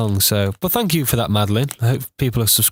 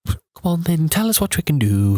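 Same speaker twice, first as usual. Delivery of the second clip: higher-pitched attempt at Scouse; amateur Scouse imitation, pitched up; bad Scouse accent; 6 semitones higher than usual. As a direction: do a poor Liverpool accent. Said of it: "That was bad".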